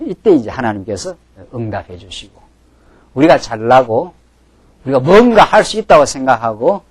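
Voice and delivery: ordinary delivery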